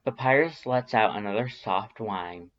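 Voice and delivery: usual speaking voice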